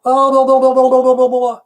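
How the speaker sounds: high, loud babbled chant; mock chanting on one high note; mock ritual chant. Imitates the maid's ritual chanting.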